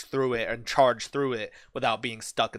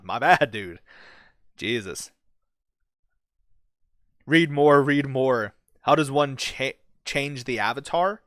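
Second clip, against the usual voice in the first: ordinary talk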